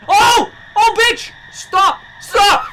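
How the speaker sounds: high, loud screams; terrified screaming. Real, not acted. Gamer attacked by the monster, screaming.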